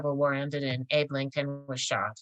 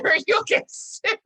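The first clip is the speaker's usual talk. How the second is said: laughed out, much higher; delivering the judge's joke while cracking up; laughing through it; 5 dB louder than usual. Real, not acted. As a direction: let the laugh break through the words.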